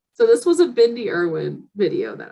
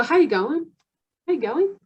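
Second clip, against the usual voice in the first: higher, lilting Aussie question; Australian rise at the end; Australian accent; 7 semitones higher than usual. Retold or imitated. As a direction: imitate a casual Australian greeting. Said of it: "they just go".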